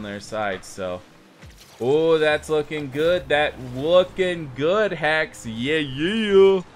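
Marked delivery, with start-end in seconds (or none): 1.8-6.6 louder, higher, rising into a big yeah; excited cheering. Real, not acted. Cheering on a teammate's promising shot, excited. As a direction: cheer the play on with mounting excitement.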